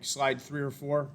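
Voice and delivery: slow, clear repeat up close. Real, not acted. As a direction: spell it out slowly for the listener. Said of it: "I'm away from the, the microphone".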